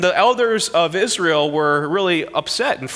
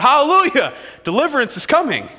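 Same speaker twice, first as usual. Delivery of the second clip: joyful praise; 7 semitones higher than usual. Acted. People praising God, voiced.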